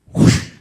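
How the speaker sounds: sharp loud whoosh of a punch. Retold or imitated. Storyteller imitating a punch cutting the air, sharp whoosh. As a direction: blow out a quick rushing whoosh.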